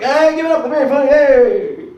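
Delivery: high, loud emcee hype; host intro. Retold or imitated. Imitating the club host introducing him, loud emcee hype. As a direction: pump up the crowd like an MC. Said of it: "everyone's like".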